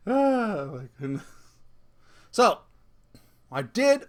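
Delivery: ordinary talk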